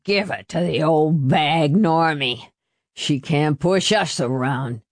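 slurred boozy egging-on; thick drunken voice; drunken father's voice. A narrator's reading. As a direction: slur it like a drunk cheering on his son. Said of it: "his father said".

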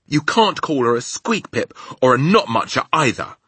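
high, loud indignant objection; growing anger. Acted. Sophie defending the Queen from insults, getting angrier. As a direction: object with rising temper. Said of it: "getting angrier and angrier".

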